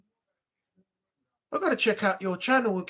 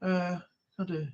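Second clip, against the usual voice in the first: ordinary delivery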